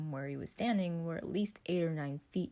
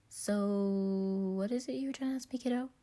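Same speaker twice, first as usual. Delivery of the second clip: fast, higher cheerful question; bubbly higher question; eager question; 5 semitones higher, 3 dB louder than usual. Acted. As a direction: ask quickly, bubbly. Patton eagerly asks Virgil.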